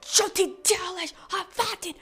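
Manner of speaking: high, indignant mock yell in Russian; high-pitched retold outburst; imitated yelling. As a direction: imitate a friend scolding in Russian. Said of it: "yelling, like".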